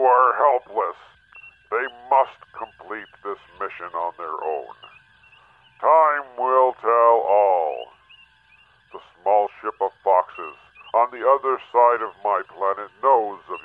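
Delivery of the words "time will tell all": slow, drawn-out pronouncement; slow ominous. Acted. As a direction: weigh every word slowly. Mysterious voice's solemn pronouncement.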